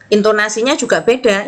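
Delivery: ordinary talk, nothing marked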